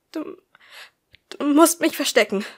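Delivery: high, loud breathless plea after running; panting. A narrator's reading. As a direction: gasp it out, still out of breath. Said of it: "Sie keuchte".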